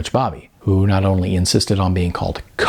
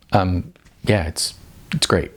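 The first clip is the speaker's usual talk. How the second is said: unenthused; 4 semitones lower than usual. A narrator's reading. Sarah unenthused reply to Tracy.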